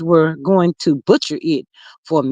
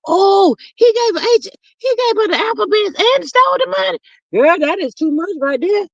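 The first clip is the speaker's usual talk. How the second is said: much higher, fast and incredulous; shocked amusement; 12 semitones higher than usual. Real, not acted. Reacting to the chat's gossip, scandalized.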